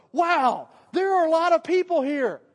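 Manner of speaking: loud, high exclamation at a crowd; mock amazed shout. Preacher acting out Jesus seeing the crowd, amazed shout. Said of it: "He goes".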